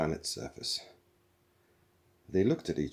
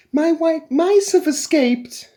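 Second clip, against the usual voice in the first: much higher, louder, slow panicked cry; shrill alarm; 14 dB louder, slower than usual. A narrator's reading. Trillian bursting in about her mice, high panicked cry. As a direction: squeal it out in alarm.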